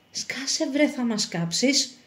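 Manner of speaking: soft, secretive scolding; hushed warning. Acted. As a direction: shut a companion up without being overheard. Silencing companion before the soldiers.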